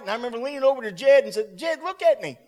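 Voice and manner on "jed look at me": higher, insistent call; urgent appeal. Retold or imitated. Preacher leaning over Jed, urging him urgently. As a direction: urge him to pay attention. Urging sick man in retold scene.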